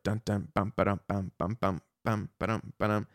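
short clipped vocal riff imitation; staccato. Shown as a demonstration. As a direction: chug the riff out in short syllables. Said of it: "It's a very staccato guitar part".

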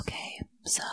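soft breathy whisper. Acted. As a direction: whisper it to the mic. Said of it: "now you're just whispering".

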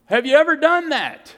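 challenging question. Real, not acted. Preacher challenging congregation, loud and high.

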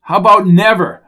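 loud, high annoyed retort; exasperated, emphatic comeback; curt refusal. A narrator's reading. Jones cuts Hooper off.

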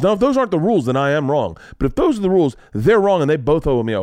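high, loud, emphatic case-making; retold indignant arguing. Parent demanding apology from two teachers, indignant and loud. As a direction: lay down the logic with rising heat. Retold quarrel over parking rules.

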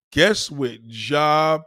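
slow, stretched build-up; drawn-out suspense. Real, not acted. Storyteller teasing listeners with the question, drawn out. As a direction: drag each word out to tease the answer.

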